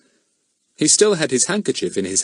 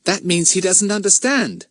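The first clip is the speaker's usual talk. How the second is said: put-on boy's voice; 9 semitones higher than usual. A narrator's reading. Pip explains to Betts.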